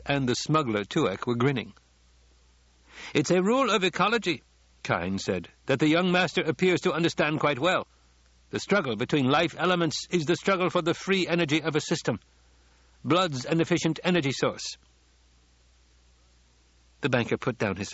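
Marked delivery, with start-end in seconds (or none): 3.1-4.4 higher, pointed character voice; assured ecologist. A narrator's reading. Ecologist backing the young master at dinner, assured and higher-pitched. 5.7-14.8 higher, pointed character voice; assured ecologist. A narrator's reading. Ecologist backing the young master at dinner, assured and higher-pitched.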